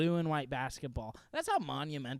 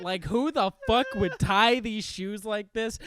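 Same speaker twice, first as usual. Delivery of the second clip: loud, high, exasperated dad rant; hammed-up furious outburst, slow and heavy; 7 semitones higher, 10 dB louder than usual. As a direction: rage at whoever tied these shoes. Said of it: "Just fucking murdering whoever tied".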